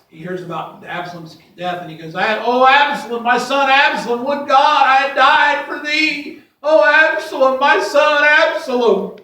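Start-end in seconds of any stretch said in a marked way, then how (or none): 2.1-9.2 high, loud wailing lament for a son; loud grieving wail; David's cry of grief. Acted. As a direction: cry out for the dead son, voice breaking. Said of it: "David cries".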